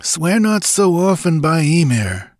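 high-pitched, uneasy caution; uneasy warrior. A narrator's reading. Warrior cautions comrade about the god's name, uneasy. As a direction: warn him with a touch of unease. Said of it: "uneasily muttered a warrior".

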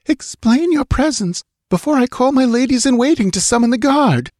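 high, imperious and alarmed; alarmed queen's demand. A narrator's reading. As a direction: demand it high, threatening to call help. Frightened queen threatens intruder.

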